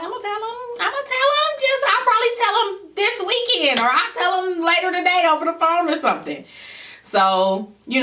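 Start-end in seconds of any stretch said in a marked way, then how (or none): 0.0-6.4 much higher, mocking her own brush-off; mock dismissive self. Telling her boyfriend she'll tell dad later, high mock brush-off. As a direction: play your past self breezily putting him off.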